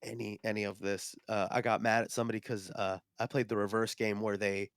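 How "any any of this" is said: soft, deflated aside; sheepish muttering. Retold or imitated. Non-expert facing wood and gemstone connoisseurs, sheepish and quiet. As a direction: admit it quietly, out of your depth.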